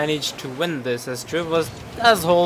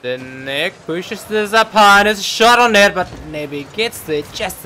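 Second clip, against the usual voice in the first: rising, louder call, stretched out; excited play-by-play; 5 semitones higher, 8 dB louder than usual. Real, not acted. Caster calling a shot on goal, excited raised voice. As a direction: build up to the shot.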